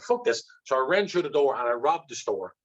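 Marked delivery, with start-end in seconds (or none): 0.0-0.4 angry outburst. Retold or imitated. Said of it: "But then I got really angry and I said".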